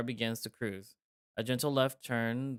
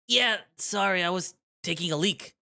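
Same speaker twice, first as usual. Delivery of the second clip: nervous lie; 6 semitones higher, 4 dB louder than usual. Acted. Tom lies to eagle.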